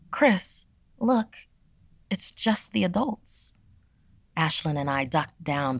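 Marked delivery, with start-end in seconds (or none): 0.1-3.5 frightened. Acted. Girl sees teachers gone mad.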